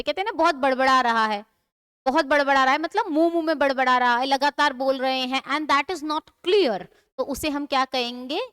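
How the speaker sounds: usual speaking voice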